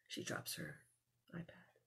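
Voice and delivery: soft whisper to the listener; whispered aside. Real, not acted. Aside while reading to child.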